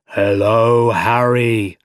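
loud, stretched villain hello; Doc Ock, menacing greeting. A narrator's reading. Doc Ock greeting Harry after attacking him, drawn-out menace. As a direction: draw it out like a looming villain.

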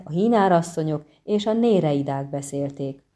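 ordinary delivery

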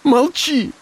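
high, sobbing cry; sobbing shout. Acted. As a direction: shout through sobs. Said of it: "Она рыдала и кричала на него в голос".

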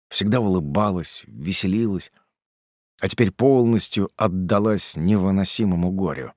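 plain reading voice

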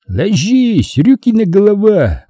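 high-pitched warning shout; urgent high holler; yelled threat. A narrator's reading. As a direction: order him down, calling from afar. Pursuer yelling at fugitive.

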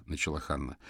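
ordinary delivery, nothing marked

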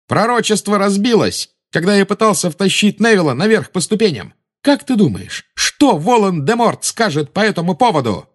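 high mocking young voice; taunting Harry. A narrator's reading. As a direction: needle her about the master. Harry goads Bellatrix.

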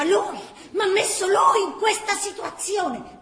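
high, loud peevish outburst; irritable voice. Acted. Girl snaps at the old man, loud and irritable. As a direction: shout it out in a huff. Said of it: "la voce è stizzosa".

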